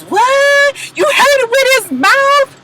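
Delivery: high-pitched mock shock, drawn out; exaggerated, put-on high squeal of surprise; fake surprise. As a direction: imitate someone badly faking astonishment. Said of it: "Carly fake surprise".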